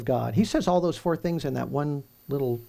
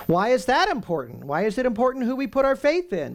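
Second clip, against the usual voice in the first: emphatic question; 10 semitones higher than usual. Real, not acted. Preacher asks rhetorical question.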